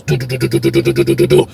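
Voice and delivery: low mechanical repeated do sounds; imitated door buzz. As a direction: mimic the cell doors popping. Imitating the doors unlocking.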